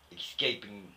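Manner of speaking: ordinary talk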